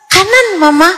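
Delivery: put-on high-pitched little child voice; child answering Mama. Acted child answering mother. (suara anak kecil menjawab mama)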